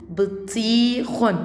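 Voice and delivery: بطيخ read very slowly with a drawn-out ee; slow word demonstration. Shown as a demonstration. Teacher reading words to first graders, slow demonstration. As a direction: stretch بطيخ syllable by syllable. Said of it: "تعالوا نقرأ الكلمات".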